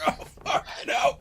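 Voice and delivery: voiceless, strained spluttered curse; Latham. Acted. Latham coughing and spluttering in the water, strained curse. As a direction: swear with almost no voice. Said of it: "You hear Latham's voice".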